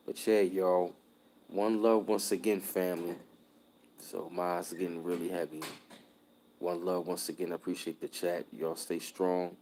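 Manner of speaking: ordinary talk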